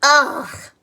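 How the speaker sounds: loud, high cry of disgust; girl grossed out by cow-kissing; disgusted ugh. Acted. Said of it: "said Annalisa".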